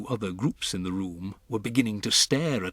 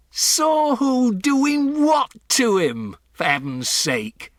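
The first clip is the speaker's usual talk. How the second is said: loud, much higher, slow bellow; exasperated shout; 7 dB louder, slower than usual. Acted. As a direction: roar in baffled fury. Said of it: "shouted Mister Jenkins".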